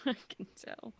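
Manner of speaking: ordinary talk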